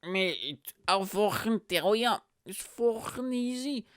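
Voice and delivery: high mimicked drunk, dragging the words; drunk guy impression. Drunk butting into podcast talk, high mimicked drawl. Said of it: "He's like".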